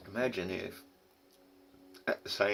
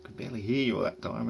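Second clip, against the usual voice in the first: imitating annoyed listener; 5 semitones higher, 6 dB louder than usual. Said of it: "You're probably gonna say".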